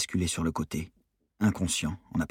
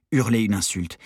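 ordinary delivery, nothing marked

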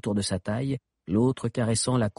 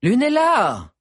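loud call, name thrown high; 13 semitones higher, 7 dB louder, slower than usual. Acted. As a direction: call her name across the crowd. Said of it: "répéta". (appel fort et aigu)

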